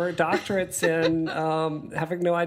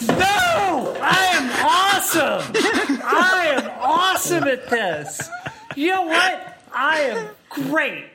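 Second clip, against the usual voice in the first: high, loud, laughing hype; excited self-affirmation; 11 semitones higher, 5 dB louder, slower than usual. Pretend. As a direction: cheer yourself on, loudly. Self-affirmation pep talk, shouting.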